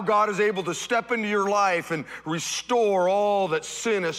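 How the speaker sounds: high, loud, rising sermon cadence; building preaching. Acted. Pastor rousing the congregation, building fiery preaching. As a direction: preach it with mounting fervour.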